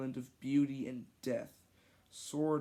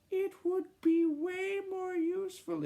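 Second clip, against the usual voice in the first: put-on kid voice; 5 dB louder than usual. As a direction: speak as a small boy. Ross kid voice.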